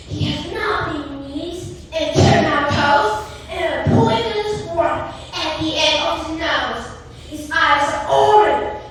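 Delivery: usual speaking voice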